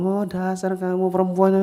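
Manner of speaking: high, slow and contemptuous; scornful husband. Acted. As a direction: sneer it at your wife. Angry husband scolds his wife.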